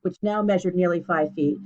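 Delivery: ordinary delivery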